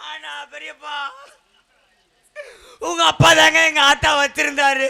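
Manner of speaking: mock crying. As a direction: swell into a bawling comic lament. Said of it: "ஏய், இப்ப நீ ஏன் அழுகுற?". (மெல்லத் தொடங்கி உரக்கக் கதறும் போலி அழுகை)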